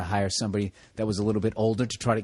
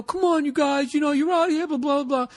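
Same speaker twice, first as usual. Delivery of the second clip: whiny boss voice, much higher; high-pitched voice; 14 semitones higher than usual. Retold or imitated. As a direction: squeak out a nagging supervisor. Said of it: "he had this high-pitched voice".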